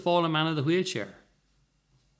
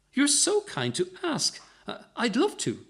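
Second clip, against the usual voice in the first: put-on old lady; 10 semitones higher than usual. A narrator's reading. Old lady accepts dance.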